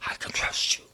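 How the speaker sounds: breathy voiceless whisper. Pretend. Believer whispering trust to Jesus, in a whisper. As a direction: barely breathe the words out. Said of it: "I'll whisper it".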